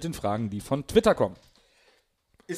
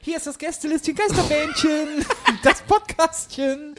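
squeaky cute voice; 11 semitones higher than usual. Acted. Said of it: "dann machen wir noch mal".

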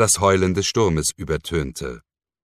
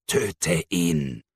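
softer hissing demon voice; demonic whisper; 4 dB quieter than usual. Acted. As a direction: hiss it like a demon tempting. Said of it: "zischte der Dämon". (dämonisches Zischen, leiser)

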